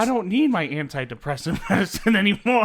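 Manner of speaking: high, loud, laughing through the words; laughing outburst. Pretend. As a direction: crack up while protesting. Joking outburst amid laughter.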